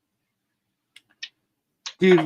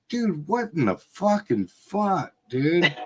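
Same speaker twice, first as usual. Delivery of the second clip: higher, aghast mock outburst; mock disbelief; 6 semitones higher than usual. Dad telling the story of his outrage: higher aghast mock outburst. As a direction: act stunned at the whole scene. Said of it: "and I was like".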